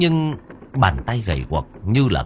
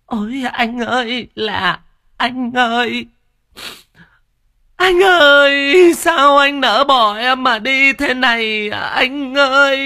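much higher, louder, slow hammed-up wail; fake wailing widow; 6 dB louder, slower than usual. Cúc fakes grief for her dead brother, hammed-up wail. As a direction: overact the grief at the funeral. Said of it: "cố tình vật vã gào lên gào xuống".

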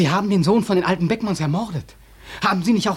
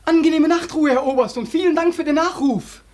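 mock polite farewell; 9 semitones higher than usual.

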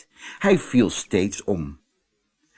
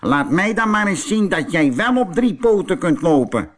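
angry; 8 semitones higher, 4 dB louder than usual. Acted. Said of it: "zei boos".

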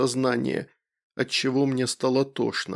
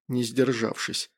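usual speaking voice

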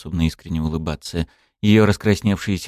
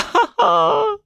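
manic laugh. Acted. Laughter turning to crying.

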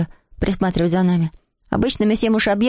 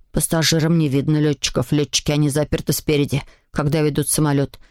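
put-on much lower, matter-of-fact boy; sceptical schoolboy; 6 semitones lower than usual. A narrator's reading. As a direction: refute the boast in a low boy's voice. Voices Clotaire refuting the boast.

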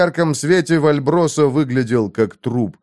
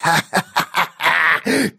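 creaky laugh; 6 semitones higher than usual. A narrator's reading. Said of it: "затрясся в приступе скрипучего смеха".

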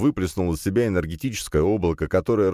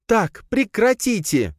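high, drawn-out order to stop; commanding shout; 3 dB louder, slower than usual. A narrator's reading. Princess stepping between quarrelling men, commanding shout. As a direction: cry out to break up a fight. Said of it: "крикнула Надя".

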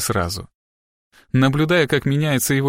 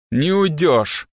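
mild, higher-pitched bark; 4 semitones higher, much slower than usual. A narrator's reading. Boss stops the fleeing girl, barking. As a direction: bark it to stop her. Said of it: "гаркнул Страйк".

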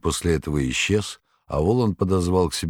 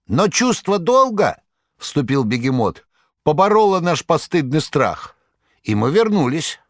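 high, fussy tomcat voice pleading his case; a boastful cat protesting his heroics, high; cat Behemoth; 10 semitones higher, 4 dB louder than usual. A narrator's reading.

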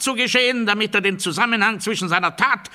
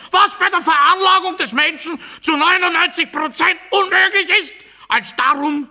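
higher, louder orator's rant; ranting speech; 9 semitones higher, 5 dB louder than usual. Acted. Speaker rising into a rally-style rant, loud and high. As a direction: declaim it like a rally speech building to a peak.

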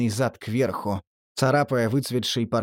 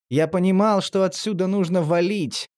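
ordinary delivery

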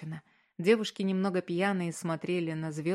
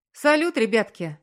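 loud, higher, cheerful call; joyful greeting; 5 semitones higher, 9 dB louder than usual. A narrator's reading. Sister arriving at a tense house, joyful. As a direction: burst in happily and hail them. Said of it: "раздался радостный голос".

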